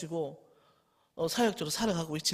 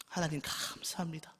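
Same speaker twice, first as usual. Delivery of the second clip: breathy whisper of thanks to God; hushed grateful prayer; 3 semitones lower, 11 dB quieter than usual. Acted.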